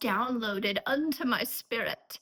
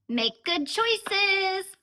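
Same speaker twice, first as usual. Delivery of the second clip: high, louder, drawn-out parting call; teasing, slow, high-pitched singsong; playful sing-song; 8 semitones higher, 4 dB louder, much slower than usual. Pretend. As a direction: sing it out slowly like a send-off. Playful sing-song sign-off to viewers.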